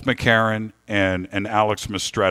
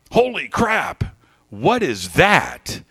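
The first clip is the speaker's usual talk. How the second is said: high, drawn-out cry of alarm; acted startled yell, stretching the words; retold yell; 7 semitones higher, slower than usual. Passenger spotting something through the sunroof, retold high yell. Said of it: "yells out".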